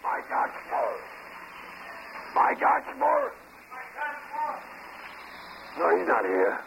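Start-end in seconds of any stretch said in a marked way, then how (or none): 2.3-3.3 raised, high call across a noisy bar, rising at the end. Real, not acted. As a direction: call the name out to the whole room. Bartender calls the name across the bar.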